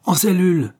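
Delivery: high, loud military command; barked order. Acted. Officer to the guard in the corridor, shouted order. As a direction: call it sharply down the corridor.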